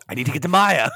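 hammed-up urgent catchphrase; lightly mocked man on a mission; mock desperate hero. Mimicking the film hero's desperate refrain.

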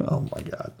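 laughing whisper. Real, not acted. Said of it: "Why are we whispering?".

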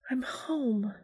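soft high whisper. Acted. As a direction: breathe it out softly. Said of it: "Lydia whispered".

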